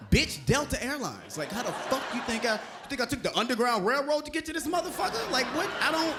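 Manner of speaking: high-pitched, exasperated disbelief; incredulous comeback. Retold or imitated. Comic retelling his comeback to her question, incredulous.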